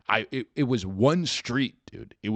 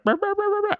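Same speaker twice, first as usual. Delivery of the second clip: mock grumbling noise; 6 dB louder, faster than usual. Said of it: "They went".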